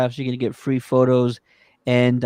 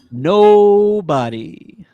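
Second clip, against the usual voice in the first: NOBODY stretched very slowly, high and loud; drawn-out emphasis; 7 semitones higher, 8 dB louder, much slower than usual. Real, not acted. Echoing co-host's hype, hugely drawn-out NOBODY. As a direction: draw it right out, loud and rising.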